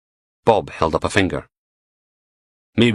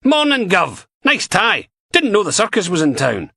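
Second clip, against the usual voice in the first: lightly voiced gruff Scottish inspector, higher and louder, mocking; a touch of a sardonic DI; Steele voiced; 4 dB louder than usual. A narrator's reading. Steele needling Finney from the doorway, mocking.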